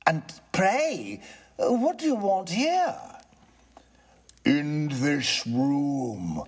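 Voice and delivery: high, slow, lofty spirit; a solemn ghost warns him off; ghost's voice. Acted. Ghost warns the tenant about the poker, slow and eerie.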